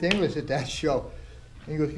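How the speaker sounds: usual speaking voice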